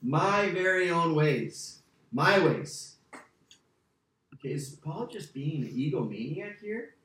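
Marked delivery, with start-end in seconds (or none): 0.0-3.0 "My very own ways" slow, every word leaned on, then "My ways" with a loud "My"; slow and heavy on "my ways"; emphatic my ways. Shown as a demonstration. Preacher showing Paul's claim to the class, slow and emphatic. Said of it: "very emphatic the way he says".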